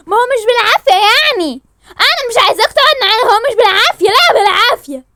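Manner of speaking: high, loud angry argument; heated shouting. Acted. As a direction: shout him down. (صراخ خناق حاد)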